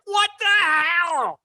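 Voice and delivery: high, slow, drawn-out disbelief. Retold or imitated. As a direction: stretch it out in disbelief. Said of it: "It's like".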